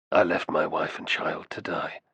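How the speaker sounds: low, soft broken admission; the memory breaks him; grieving confession. A narrator's reading. Grief over burning village.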